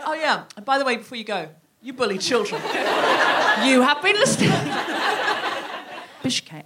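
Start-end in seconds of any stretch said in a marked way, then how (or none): 0.1-4.5 laughing. Retold or imitated. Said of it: "I was saying".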